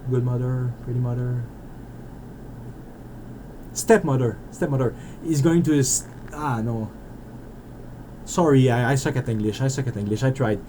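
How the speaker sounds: ordinary delivery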